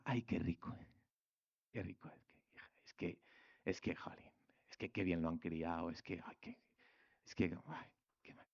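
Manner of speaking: put-on soft, half-whispered cooing old lady; gushing church lady. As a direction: coo over him like a doting church lady. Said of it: "te dirá". (señora de la iglesia embelesada, susurrando)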